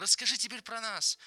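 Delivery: put-on delighted, childlike eagerness; joyful plea. A narrator's reading. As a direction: ask happily, pitch lifted high. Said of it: "радостно подхватил Леня".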